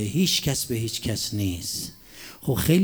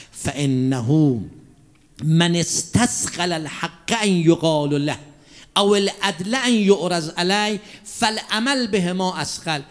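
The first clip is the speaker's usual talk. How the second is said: slow, raised chanting of scripture; preaching recitation; 4 semitones higher, slower than usual. Acted. Preacher reciting Imam Ali's words to the congregation, chanted. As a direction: proclaim the Arabic like a pulpit chant.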